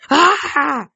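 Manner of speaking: high, loud "ah"; cry of pain. A narrator's reading. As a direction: cry out as if stabbed. Villain stabbed through the chest.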